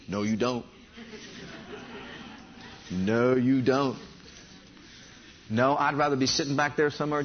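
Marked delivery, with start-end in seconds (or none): 0.1-0.6 low, soft, matter-of-fact denial; quiet, unstretched warning; low rebuttal. Real, not acted. First take of repeated rebuttal. 2.9-4.0 very slow, lowered denial; slow, deliberate contradiction; drawn-out rebuttal. Real, not acted. Repeated rebuttal, drawn out for emphasis.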